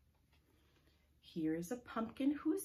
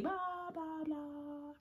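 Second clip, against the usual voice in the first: noisy pumpkin; 10 semitones higher, much slower than usual. Shown as a demonstration. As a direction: babble it high like a noisy pumpkin. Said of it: "pumpkin who is noisy".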